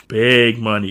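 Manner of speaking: low scheming echo. Retold or imitated. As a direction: say it low and greedy.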